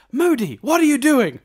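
high, startled question; mock shocked student. Podcast host quoting movie scene, startled student voice. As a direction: play the shocked onlooker calling to Moody.

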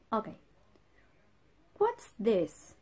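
usual speaking voice

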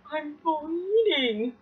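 high, slow, small frightened voice; scared whimper. Retold or imitated. Girl telling her aunt she's bleeding, scared and slow. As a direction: say it like a terrified kid. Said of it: "I'm crying because I'm like".

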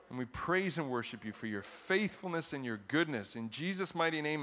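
earnest, measured prayer voice; congregational prayer. Real, not acted.

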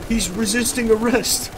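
high panicked shouting; panicking gamer. Real, not acted. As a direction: yelp under attack. Getting attacked in game.

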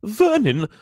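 high, loud anxious call; Aunt Petunia quivering. A narrator's reading. Aunt calling her husband, quivering voice. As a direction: call your husband in alarm. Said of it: "was saying in a quivering voice".

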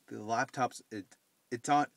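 ordinary talk

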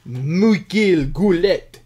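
slow, drawn-out mock French accent; French Canadian accent. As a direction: stretch the name out in French Canadian style. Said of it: "Frenching it up there".

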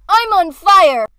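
loud exclamation, falling at the end; acted shout. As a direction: shout it out as a performed line. Rehearsing an exclaimed line.

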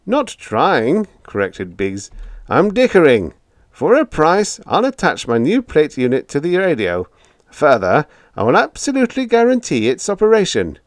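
high, loud cocky bargaining; confident, unbudging. A narrator's reading.

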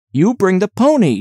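indignant exclamation. A narrator's reading. Catherine ordering the woman about, mildly indignant. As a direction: exclaim it as a command, pitch lifted. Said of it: "she exclaimed".

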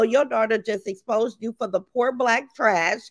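usual speaking voice

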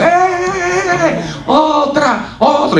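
put-on children begging the clown for another song; kids cheering. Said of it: "Y empiezan".

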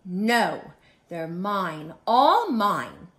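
loud, possessive outburst, peaking at the end; storybook tantrum, not a full scream; grouchy mock scream. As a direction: cry out like a greedy, grouchy bug. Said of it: "screamed the grouchy ladybug".

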